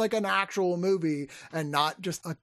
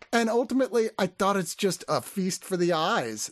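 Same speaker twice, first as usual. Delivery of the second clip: usual speaking voice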